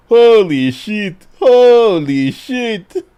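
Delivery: loud, drawn-out astonished shout; stunned shout. Real, not acted. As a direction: blurt it out in total shock. Stunned by image shown.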